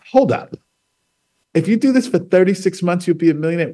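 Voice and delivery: stunned disbelief. Retold or imitated. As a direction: replay the moment the money promise sank in. Retelling disbelief at the book's promise.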